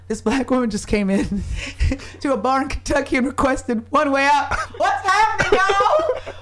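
high, laughing mock astonishment; amazed bar crowd. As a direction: play the stunned bar locals. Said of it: "they were like".

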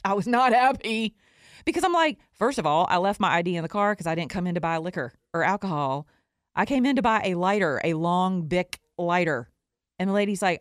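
ordinary talk, nothing marked

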